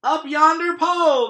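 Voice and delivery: high, loud crew echo; crew shout. Acted. Pirate crew echoing the captain, shouting. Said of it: "the others shouted".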